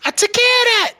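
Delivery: high-pitched movie-line imitation; Bokeem Woodbine impression. Co-host quoting immortal words to the crew, high put-on impression. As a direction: quote it high, as a repeated catchphrase. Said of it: "In the, in the immortal words".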